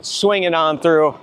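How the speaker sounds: usual speaking voice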